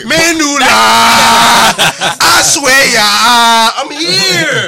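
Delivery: loud, high, rowdy yelling. Real, not acted. Host yelling into the mic, loud and rowdy. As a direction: shout into the mic, belligerent.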